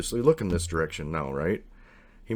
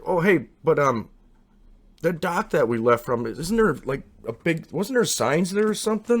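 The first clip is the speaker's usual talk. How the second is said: high, raised eager question; 9 semitones higher, 5 dB louder than usual. Retold or imitated. Narrator calling a question about the dock to his friend, high and eager. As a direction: call it out, excited and fishing.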